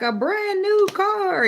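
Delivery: very high, loud, drawn-out prize reveal; game show announcer. Retold or imitated. Imitating The Price is Right revealing a car, hyped announcer. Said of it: "on The Price is Right, they be like".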